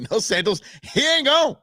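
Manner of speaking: laughing through the words; real amused chuckle. Real, not acted. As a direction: catch yourself and laugh it off. Laughs at his own mistake.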